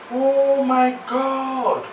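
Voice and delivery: slow, hushed disbelief; stunned. Acted. Man hears of the money on the phone, stunned and slow. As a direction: let it sink in slowly.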